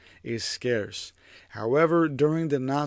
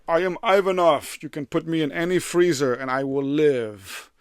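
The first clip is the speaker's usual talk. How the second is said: higher, louder put-on hero voice; boastful Ivanov; 3 semitones higher, 5 dB louder than usual. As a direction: puff up like a superhuman bragging.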